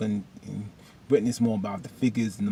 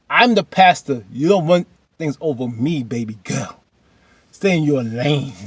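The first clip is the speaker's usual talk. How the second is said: firm retold pastor; 3 semitones higher, 7 dB louder than usual. Pastor putting wife in place.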